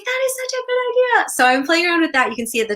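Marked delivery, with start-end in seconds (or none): 0.0-1.3 high, thrilled quote of herself; excited squeal. Retold or imitated. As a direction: squeal it like the idea just hit. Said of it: "And I'm like".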